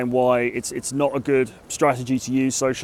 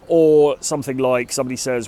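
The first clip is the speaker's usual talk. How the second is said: normal talking voice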